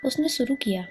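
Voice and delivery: ordinary delivery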